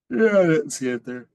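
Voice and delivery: yawning. Real, not acted. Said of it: "Sorry for the yawn".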